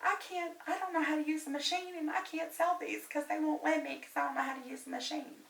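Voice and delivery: put-on high, soft, helpless girl's voice; imitating young clerk. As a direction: play the flustered clerk who can't work the machine. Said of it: "and the little girl says".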